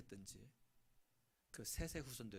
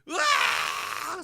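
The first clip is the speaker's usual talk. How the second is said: long, drawn-out louder mock scream of pain; childbirth scream; 4 semitones higher, 21 dB louder, much slower than usual. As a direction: stretch out a labor cry.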